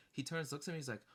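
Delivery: ordinary delivery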